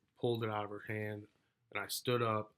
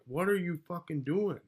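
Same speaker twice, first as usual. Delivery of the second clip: higher, louder incredulous outburst; indignant demand; 9 semitones higher, 5 dB louder than usual. Retold or imitated. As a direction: demand an answer, exasperated and loud. Acted quarrel, confronting her at window.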